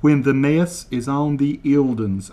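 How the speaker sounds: slow, deliberate over-enunciation of each word; slow mock translation. Shown as a demonstration. Translating Kevin's Scottish for viewers, slow and deliberate. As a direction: spell it out slowly for Americans, deadpan. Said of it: "translate word for word".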